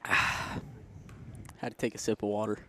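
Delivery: soft, slow breathy murmur; accidental ASMR whisper; quiet breathy aside. Real, not acted. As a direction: lean into the mic softly. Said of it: "Didn't know this was an A S M R stream".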